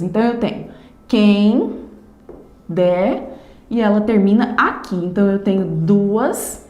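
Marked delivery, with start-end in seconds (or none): 1.1-3.4 "quem", pause, "der", each syllable set apart. Shown as a demonstration. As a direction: count out "quem" and "der" as separate beats. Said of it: "fazer a contagem desses versos, dessas sílabas".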